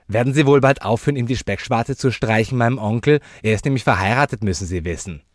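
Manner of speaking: put-on loud, high, fast child's voice; cheeky child. Child telling the lady off about her uncle, loud and fast. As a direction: rattle it off loudly like a brat.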